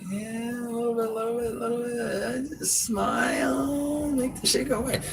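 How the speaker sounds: mock shy girl. Mimics girl's shy tipsy reply.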